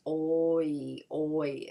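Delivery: oi with lips going rounded to spread; over-mouth oi, rounded lips opening wide; exaggerated oi. Shown as a demonstration. Teacher demonstrating the diphthong, over-mouthed oi. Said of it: "from rounded lips to spread lips".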